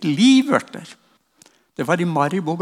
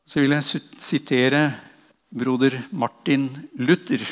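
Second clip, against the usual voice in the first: normal talking voice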